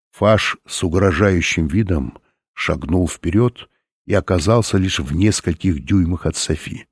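ordinary delivery

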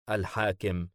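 ordinary delivery, nothing marked

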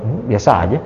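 low, offhand shrug of a reply; indifferent husband. Retold or imitated. Husband asked if he loves his wife, answering with a low indifferent shrug. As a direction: brush it off like it's nothing.

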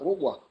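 "ربوة" said rubwa, with a u after the ر; u vowel after ر. Shown as a demonstration. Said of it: "بضم الراء". (نطق "ربوة" بضم الراء)

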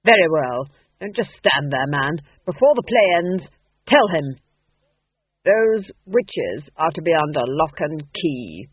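forceful, commanding duchess; imperious duchess. A narrator's reading. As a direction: issue orders to an underling. Duchess gives orders.

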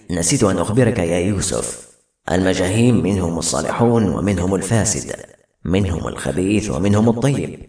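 low, grave figure with an altered timbre; cautioning otherworldly leader. Acted. Leader of the Majaheem cautioning Yusuf, grave and low. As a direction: warn him in a deep, measured voice.

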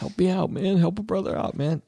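high, pleading addict whine; begging addict. Pretend. Addict begging his dealer for tea. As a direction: beg for it in a high strained voice. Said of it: "It's like".

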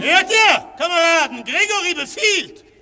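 loud high taunt to the crowd; rally the room against him with scorn; mocking shout. Syme mocks Gregory to the room.

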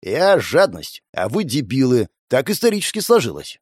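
ordinary delivery, nothing marked

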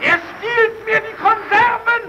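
shrill high yell, dragged out slowly; desperate high-pitched cry; outraged shriek. Acted. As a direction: scream the accusation in outrage. Quarrel over stolen food.

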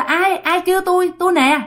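lightly acted grandmother answering; answering a call. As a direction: call back to whoever is outside.

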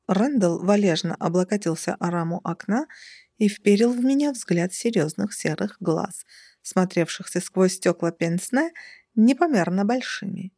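ordinary delivery

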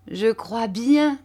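ordinary delivery, nothing marked

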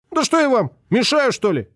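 put-on high, put-upon child; high bratty kid feigning innocence; indignant boy. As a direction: protest like a wronged little boy. Boy argues with the lodger.

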